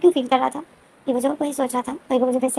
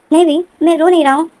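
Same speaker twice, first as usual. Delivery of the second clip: higher and louder, protesting too much; defensive denial; 5 semitones higher, 6 dB louder than usual. Retold or imitated. Evo insists to Wing he is not crying, raised denial. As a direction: deny it loudly, a little forced.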